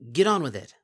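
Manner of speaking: loud impatient snap; snapping. A narrator's reading. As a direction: snap at her to hurry. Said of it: "Bon Bon snapped".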